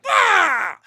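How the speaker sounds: put-on high, loud playful yelp like an unruly kid; hammed-up yell of a kid let loose; mock kid yell. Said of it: "the kid's like".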